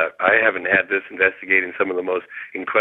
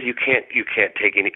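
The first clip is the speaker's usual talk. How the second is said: ordinary talk, nothing marked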